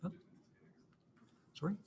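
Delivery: ordinary talk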